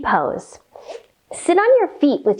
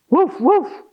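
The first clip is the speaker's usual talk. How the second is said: higher-pitched double woof; barking dog; 5 semitones higher than usual. A narrator's reading. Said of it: "said dog".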